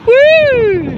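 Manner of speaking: high loud whoop; gleeful yelp. Real, not acted. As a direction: let out a big excited woo. Celebratory whoop after hashtag.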